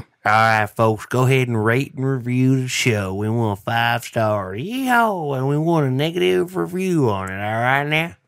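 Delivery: loud, hammed-up country yell with a high yeehaw; big put-on country twang, swooping high; country accent. Shown as a demonstration. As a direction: holler it like a country showman. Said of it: "Let's hear your best country accent".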